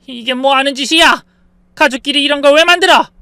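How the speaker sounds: high, loud outraged shout; acted furious mother-in-law; angry yelling. A narrator's reading. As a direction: scold the family member at full voice. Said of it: "소리를 지르셨지만".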